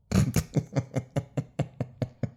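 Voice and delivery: quiet, breathy run of laughter; laughing. Real, not acted. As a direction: crack up softly after your own line. Cracks up at the line read.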